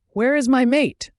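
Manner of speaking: high, loud demanding yell. A narrator's reading. Logan demands his missing mate, yelling. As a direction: raise pitch and volume sharply, demanding an answer. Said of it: "I yelled".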